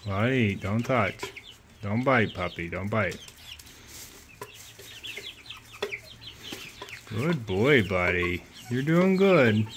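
soft, hushed coaxing to a nervous puppy; soothing pet talk. Real, not acted. Owner calming her puppy near the chicks, soft and soothing.

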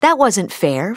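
ordinary delivery

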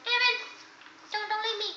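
pleading candlestick. Acted. Candlestick begs David not to leave it. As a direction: beg not to be left behind.

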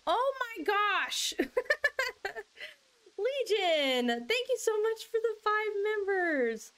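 high, excited outburst with a laugh; thrilled surprise. Real, not acted. Streamer thanking a viewer for gifted memberships, thrilled. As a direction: burst into delighted, high-pitched thanks.

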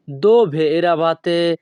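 dove eravate stretched out slowly and clearly; slow it right down for learners; careful slow classroom demo; slow teaching pace. Shown as a demonstration. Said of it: "দুই থেকে তিনবার বলার চেষ্টা করব".

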